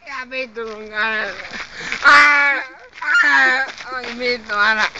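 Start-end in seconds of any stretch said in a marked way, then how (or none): 2.0-3.9 frustrated yells. Real, not acted. Gaming frustration.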